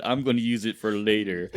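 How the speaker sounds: mustache twirling villain, laughing; sinister villain line with a chuckle; cartoon villain. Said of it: "You just sounded like a cartoon villain".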